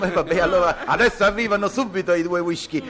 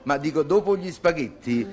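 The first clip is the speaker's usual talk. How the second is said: ordinary talk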